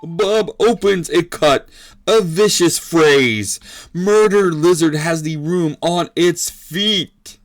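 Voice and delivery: big, drawn-out ringside excitement; fight commentator. Acted. Commentator calling Bob's vicious phrase, excited play-by-play. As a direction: stretch the key words at high pitch.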